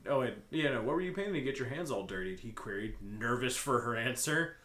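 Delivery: usual speaking voice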